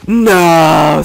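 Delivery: loud anguished no; a loud stricken no; dismayed shout. Retold or imitated. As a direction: cry out in dismay. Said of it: "I was like".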